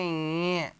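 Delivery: ञ said through the nose, slowly; nasal nya. Shown as a demonstration. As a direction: let ञ ring in the nose. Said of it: "इसको बोलेंगे". (नाक से ञ)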